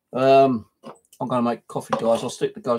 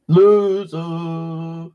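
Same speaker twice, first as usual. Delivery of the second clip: higher, louder sing-song jeer; mocking taunt; 6 semitones higher, 5 dB louder than usual. Real, not acted. Streamer jeers at viewers who thumbs-down his stream, in a mocking voice. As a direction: jeer at the trolls in a put-on voice.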